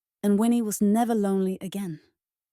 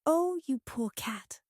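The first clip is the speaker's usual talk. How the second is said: soft, slow, higher-pitched cooing; sympathetic; 5 semitones higher, 4 dB quieter than usual. A narrator's reading. Winnie comforts a scraggly stray cat, tender sympathy. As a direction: coo it with pity.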